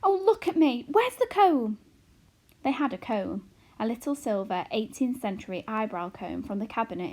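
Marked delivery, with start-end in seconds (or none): 0.0-1.8 higher and louder, in a fluster; flustered mother. A narrator's reading. Mother hurrying to tidy up before her husband enters, flustered. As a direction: fuss over her looks.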